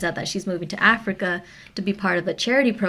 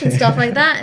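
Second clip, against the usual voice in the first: ordinary talk, nothing marked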